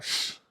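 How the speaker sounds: brushing him off with a shush; quick hush to wave it away; dismissive shush. Retold or imitated. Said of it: "I was like".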